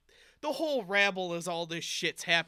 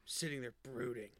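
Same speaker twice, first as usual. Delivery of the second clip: brooding; 8 semitones lower, 11 dB quieter than usual. Shown as a demonstration.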